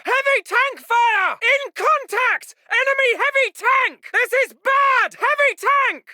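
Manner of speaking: high-pitched shouted warnings under fire; alarmed battle shout. Acted. Officer warning chaps they are under fire, alarmed shout. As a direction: yell the danger out to the whole squad.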